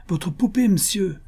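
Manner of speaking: put-on high-pitched boy calling out; street urchin's call. A narrator's reading. Urchin tossing doll into the carriage, high calling voice. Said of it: "cria un gamin".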